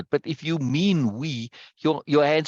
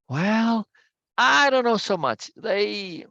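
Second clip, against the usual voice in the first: higher, doubtful hesitation; dubious; 8 semitones higher, much slower than usual. A narrator's reading. Ursula doubting the delegates, higher and hesitant. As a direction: hold back, unconvinced. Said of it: "Ursula adopted a dubious expression".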